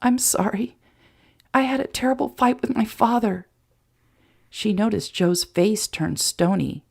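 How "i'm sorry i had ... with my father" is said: put-on higher girl's voice, apologetic; a hurt daughter confessing, lifted pitch; Mary Sue voice. A narrator's reading. Mary Sue confessing the fight to Joe, higher and hurt.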